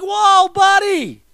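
slow, high, drawn-out startled shout; high mock alarm; startled whoa. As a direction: recoil from a snotty face. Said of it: "I'd be like".

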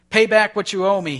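loud, high angry demand. Acted. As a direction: throttle the debtor with the words. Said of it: "he demanded".

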